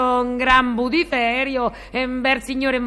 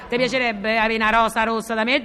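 normal talking voice

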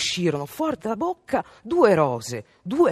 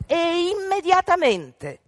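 high, loud insistent mother; insisting mother; 11 semitones higher, 5 dB louder, slower than usual. A narrator's reading. Mother insisting her rude daughter go, high firm demand. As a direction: lay down the law as the mother. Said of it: "insisté la madre".

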